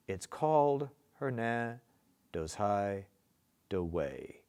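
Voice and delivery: "I know", "a dark": pause after each pair, weight on the second syllable; marking the stresses. Shown as a demonstration. Teacher marking stresses first, measured iambic beat. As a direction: chop the verse into two-syllable units, leaning on "know", "dark", "place". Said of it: "I told you to mark the stresses first".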